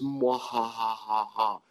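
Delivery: slow hammed-up villain laugh; a drawn-out cartoon villain cackle; evil laugh. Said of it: "he was Doctor Evil".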